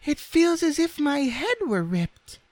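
very high, squeaky rag doll; Raggedy Ann's voice. A narrator's reading. Rag doll confessing muddled thoughts, squeaky. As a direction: speak in a tiny piping falsetto.

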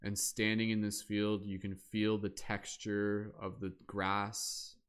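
slow, soothing meditation voice with long pauses; guided meditation. Acted. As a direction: ease them into stillness, unhurried. Continues guided body-scan meditation.

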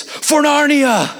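high, drawn-out rallying cry; dead-serious battle cry. Retold or imitated. Hyped freshman rallying the team, dead-serious battle cry. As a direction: imitate an earnest war cry, stretched long. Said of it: "dead serious".

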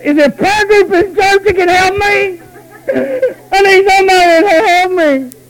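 high, loud mock sob begging for help; mock crying plea. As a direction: wail like a helpless churchgoer. Mocking churchgoer begging for help.

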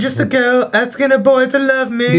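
high, breathless romantic quote; film line impression. Quoting Notting Hill's big moment in a high swooning voice. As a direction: deliver it like a rom-com heroine. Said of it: "Is that Notting Hill?".

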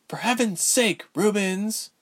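plain reading voice

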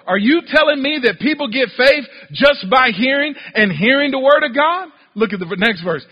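ordinary delivery

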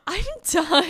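loud laugh breaking into the words; laughing hard. Real, not acted. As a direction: crack up while trying to speak. Cracking up at the joke.

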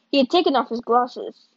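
ordinary delivery, nothing marked

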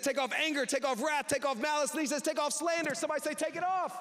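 climbing call for the church to answer; rising preacher call. Real, not acted. Preacher building to call-and-response.

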